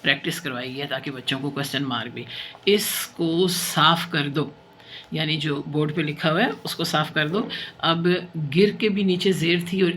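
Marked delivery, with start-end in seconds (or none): 2.6-4.5 each word drawn out slowly; slow dictation. Shown as a demonstration. Teacher reading slowly to children for dictation. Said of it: "slow dictation reading".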